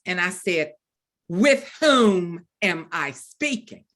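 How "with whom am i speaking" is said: slow, louder, word-by-word formal demand; haughty challenge. Retold or imitated. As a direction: draw it out with imperious suspicion. Repeats her suspicious question to caller.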